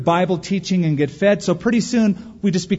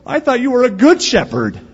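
hurt protest; 10 semitones higher, 6 dB louder than usual. Acted. Sheep protests to shearing shepherd.

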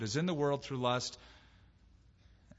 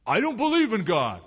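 a defiant unbeliever, higher and louder; voiced unbeliever; 7 semitones higher, 7 dB louder than usual. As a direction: toss it out like a scoffing atheist.

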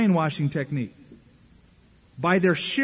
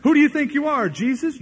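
much higher, louder scold; mock scolding parent; 11 semitones higher, 7 dB louder than usual. Parent scolding a cheeky child, much higher and louder.

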